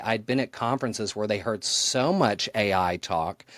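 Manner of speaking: usual speaking voice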